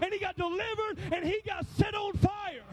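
high, loud preacher's cry; preaching at full force. Acted. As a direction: preach it to the rafters. Preaching climax.

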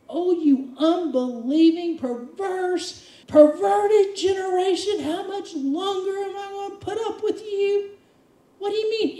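dramatic exasperated rebuke; exasperated Jesus rebuke. Acted. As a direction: cry out at the faithless crowd. Preacher voicing exasperated Jesus.

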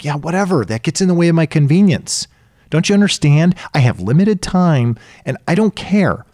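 hammed-up exasperated brush-off; dismissive protest. Voices dismissive people resisting advice.

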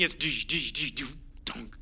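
low, soft bouncing-ball sounds, tapped out in rhythm; mock dribbling noises. Preacher recalling dawn practice as a teen, softly imitating a dribbling ball. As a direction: drum out a dribble and a dunk with your voice.

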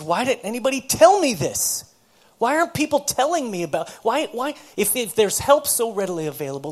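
high, loud protest, retold; exasperated demand. Woman asking counselor why nobody told her, exasperated demand. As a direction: demand answers as a woman who feels let down. Acted complaint of a frustrated woman.

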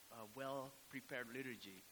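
ordinary talk

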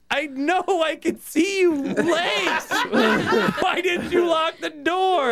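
high, drawn-out mock yelling; acted outraged cry, stretched out; exasperated shout. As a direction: holler it in comic exasperation. Acted reproach at the bathroom occupant.

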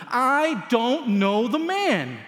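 a slow forceful oath; sworn denial. Acted. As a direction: swear it out one word at a time. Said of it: "and he swore to them".

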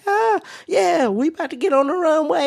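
high-pitched imitation of an excited child; excited kid. Retelling a kid on the plane before takeoff, excited mock child voice. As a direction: do a high, fast little-kid voice. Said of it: "I hear a kid in the background".

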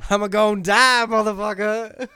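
loud, high mock-panicked yell; comic shout. Host turns the Jedi's name into a joke, shouting. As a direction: holler it as an exaggerated punchline.

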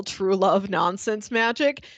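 ordinary talk

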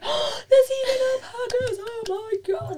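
high-pitched, gushing excited squeal; excited freak-out. Retold or imitated. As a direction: freak out with joy, high and loud. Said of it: "I freaked out, like, so excited".

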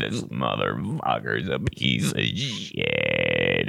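very deep, slow, groaning gut voice; low rumbling belly voice begging for death; talking stomach. Pretend. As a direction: let the stomach moan from deep down. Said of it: "your stomach's like".

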